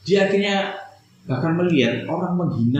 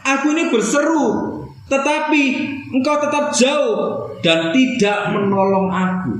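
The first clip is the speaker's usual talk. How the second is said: high cry, slowing on the last line; desperate plea; 10 semitones higher, slower than usual. Acted. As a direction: call out, then drag the complaint slowly. Voicing David's cry to God. (seruan memohon, tinggi lalu melambat)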